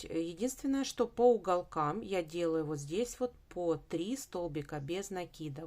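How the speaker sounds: normal talking voice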